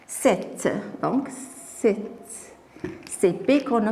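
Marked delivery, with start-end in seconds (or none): 0.1-2.0 sept with silent p, audible t; final T pronounced. Shown as a demonstration. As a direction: sound only the T ending sept. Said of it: "on prononce". (sept avec le T final prononcé)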